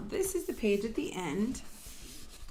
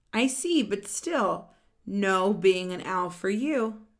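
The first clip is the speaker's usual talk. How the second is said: louder, lively read-aloud of a character line; animated storybook voice; 2 semitones higher, 6 dB louder than usual. Acted. Reading a picture book to children, animated and louder. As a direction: voice the characters brightly for the kids.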